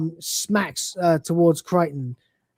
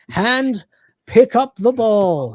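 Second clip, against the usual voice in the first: slowly spoken, louder instruction; deliberate command; 5 semitones higher, 5 dB louder, slower than usual. Retold or imitated. Crichton coaching Lister to move the arm, deliberate command. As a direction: give a measured demonstration command.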